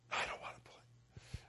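very soft, breath-only whisper; whispered hurt. Retold or imitated. Boy giving up as parents fight, whispered. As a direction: whisper it as a crushed child.

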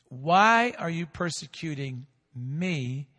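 usual speaking voice

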